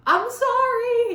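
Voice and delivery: high, drawn-out, overloud apology; too loud. A narrator's reading. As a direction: blurt it out far too loudly. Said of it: "he explains a tad too loud".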